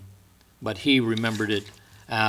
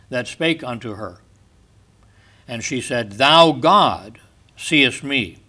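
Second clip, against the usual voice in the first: raised, solemn, declaiming reading of scripture; 4 dB louder than usual. Acted. Preacher reading Genesis to his congregation, solemn declaiming. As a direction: proclaim the verses to the congregation. Reads Genesis aloud in sermon.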